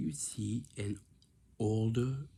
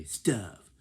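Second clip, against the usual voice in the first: curt reply; 8 dB louder than usual. Shown as a demonstration. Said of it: "They say".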